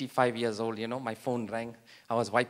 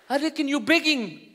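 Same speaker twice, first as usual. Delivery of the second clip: mock outrage; 9 semitones higher, 4 dB louder than usual. Confronting the begging man.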